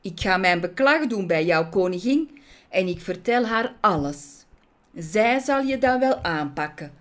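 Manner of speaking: loud threatening judge; indignant calling out. Acted. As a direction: threaten her with a formal complaint. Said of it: "riep hij".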